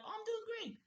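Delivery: very high, soft falsetto sweetness; mock cheerful reply. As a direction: chirp it with fake politeness. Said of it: "I'm like".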